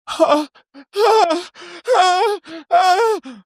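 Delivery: very high hammed-up screams; hammed-up screaming ah ah; mock scream. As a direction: squeal like a cartoon night terror. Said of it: "I thought night terrors would be".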